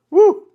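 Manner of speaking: sudden high whoop of surprise; knife nearly nicks thumb, loud high startled woo. Real, not acted. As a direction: yelp a loud high woo as the blade snaps. Knife nearly takes his thumb.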